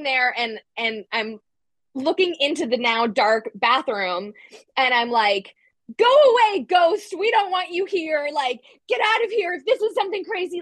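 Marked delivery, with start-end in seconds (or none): 6.0-10.6 yelling at ghost. Retold or imitated. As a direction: shout the ghost out of the room.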